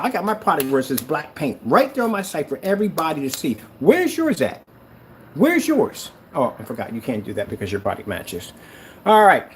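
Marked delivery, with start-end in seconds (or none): 3.8-4.6 much higher, mocking demand; mocking where's yours at; taunting challenge. Real, not acted. 5.3-6.1 much higher, mocking demand; mocking where's yours at; taunting challenge. Real, not acted.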